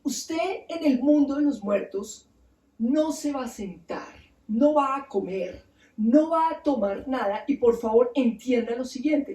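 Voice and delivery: slow, measured warning with pauses between rules; stern warning. Retold or imitated. God warns Nergal before his descent, stern warning. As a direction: lay down each rule slowly and deliberately. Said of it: "le advierte".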